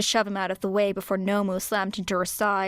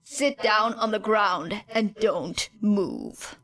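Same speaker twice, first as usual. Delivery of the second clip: very slow, even, commanding voice; a mind-control order, calm and absolute; hypnotic command; much slower than usual. A narrator's reading.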